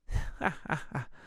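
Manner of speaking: unvoiced scoffing chuckle; airy derisive laugh; breathy mocking laugh. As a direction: laugh the question off. Cop laughs at the dude.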